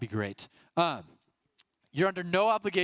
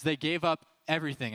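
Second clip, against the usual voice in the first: normal talking voice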